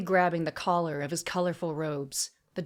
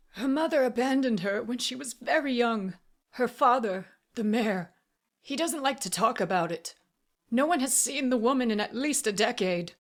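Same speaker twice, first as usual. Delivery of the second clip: plain reading voice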